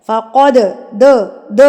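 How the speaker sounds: فقد with a bouncing, echoed د at the end; qalqala on dal. Shown as a demonstration. Teacher showing students the qalqala, bouncing د. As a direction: shake the final د of فقد so it rebounds. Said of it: "ہلا کے ترتراٹ سے پڑھنا ہوتا ہے".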